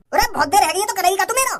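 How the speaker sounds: normal talking voice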